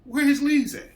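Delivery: high, loud, fed-up shouting; irritated boss losing patience over the radio; exasperated yell. Retold or imitated. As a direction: blow up at whoever keeps calling on the radio. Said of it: "I'm like".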